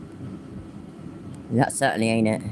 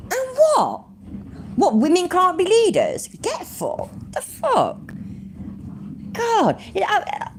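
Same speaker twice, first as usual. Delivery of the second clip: much higher, incredulous retorts; outraged mockery; 11 semitones higher, slower than usual. Real, not acted. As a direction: scoff at the post in disbelief. Outraged by anti-women post.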